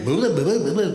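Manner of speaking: quick mock comedian patter; mock Jackie Mason accent. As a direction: lightly mimic a brash comic's accent. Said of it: "the accent".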